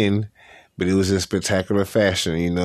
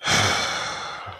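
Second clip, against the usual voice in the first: long, voiceless sigh; heavy sigh; 4 dB quieter than usual. Real, not acted. Podcaster let down by Dan Hooker, heavy sigh. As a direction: let out a long breath of disappointment.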